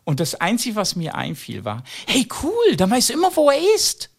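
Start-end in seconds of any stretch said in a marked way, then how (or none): 2.1-4.1 much higher, bright upbeat quip; cheery retort. Retold or imitated. Comedian answering the boy, high and cheery. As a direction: toss it off brightly. His quick retort to child.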